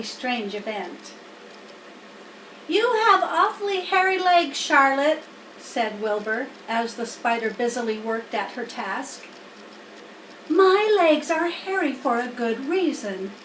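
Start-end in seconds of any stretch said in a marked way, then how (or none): 2.7-5.2 put-on higher, eager young pig voice; a wide-eyed piglet marveling; Wilbur the pig. Wilbur to Charlotte, curious young pig voice. 10.5-13.3 higher, composed spider voice; patient, knowing spider explaining; Charlotte the spider. Acted. Charlotte answering Wilbur, lightly voiced spider. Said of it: "replied Charlotte".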